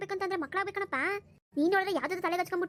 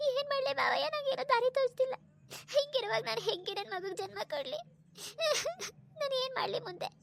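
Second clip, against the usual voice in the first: tearful complaint with sobbing pauses; crying; 4 semitones higher than usual. Acted. Abandoned wife asks father-in-law what to do, crying. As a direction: appeal helplessly through sobs. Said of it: "ಅಳ್ಬೇಡ ಸುಮ್ನಿರು".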